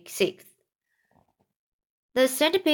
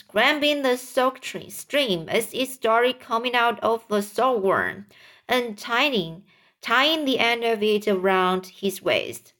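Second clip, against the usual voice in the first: usual speaking voice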